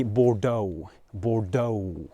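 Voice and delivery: "Bordeaux" drawn out to bor-DOH-oo; slow "Bordeaux", a little wave in the last vowel; English waved vowel. Shown as a demonstration. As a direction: say "Bordeaux" with a gliding English O at the end. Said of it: "It's like a little wave".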